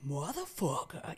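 retold New York street outburst; full-on New York. Confronting the man who pushed her, full-on New York. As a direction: go big on the New York accent. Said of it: "I went full on New York".

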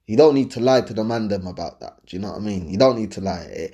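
normal talking voice

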